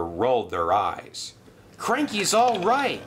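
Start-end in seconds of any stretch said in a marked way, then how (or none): 1.8-3.0 relieved gasp. Acted. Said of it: "gasped Thomas".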